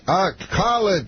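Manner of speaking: high, slow, word by word; Bill Cosby. Retold or imitated. Star answers opener in lone words, mimicked high and slow. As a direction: mimic a comic talking just in words. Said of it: "He talks, like, just in words".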